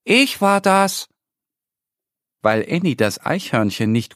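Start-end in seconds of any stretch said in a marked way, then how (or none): 0.0-1.1 high, slow little squirrel voice; small squirrel owning up, higher and slower; high squirrel calling. Acted. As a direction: call down from the treetop. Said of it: "Es winkt ihr und ruft".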